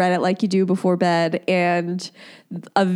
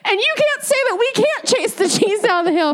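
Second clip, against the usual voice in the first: high, laughing mock-indignant villagers; mock outrage; 14 semitones higher than usual. Voicing outraged locals who defend the cheese chase, mock outrage. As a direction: ham up the furious locals, much higher. Angry villagers retold, voiced.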